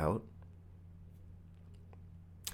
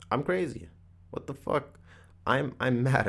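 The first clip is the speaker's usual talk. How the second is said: higher, half-laughing alarm at himself; mock freak-out; 7 semitones higher than usual. Retelling his retreat moment, higher mock alarm breaking into a laugh. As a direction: play up the panic of catching yourself, with a laugh. Realizing he spoke aloud alone.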